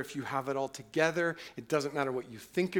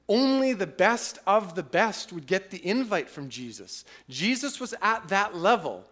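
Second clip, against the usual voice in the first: loud, high preaching voice; full-force preaching; 5 semitones higher, 7 dB louder than usual. Acted. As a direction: proclaim it to the whole church.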